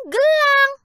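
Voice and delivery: spoiled daughter ending on a squeal; squealing demand. A narrator's reading. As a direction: squeal the last item greedily. Greedy daughter's final demand.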